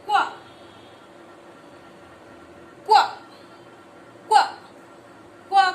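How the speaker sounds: short, isolated क्वा, as 'kwa'; phonics Q sound. Shown as a demonstration. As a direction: punch out each क्वा separately for learners. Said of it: "क्यू का जो pronunciation होता है वो कुछ इस तरीके से". (क्वा की छोटी, ज़ोरदार, अलग-अलग ध्वनि)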